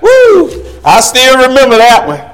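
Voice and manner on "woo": a high whooping woo, remembering the pain; rueful woo. Real, not acted. Preacher recalling his worst whipping, high rueful woo. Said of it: "worst whipping I ever got".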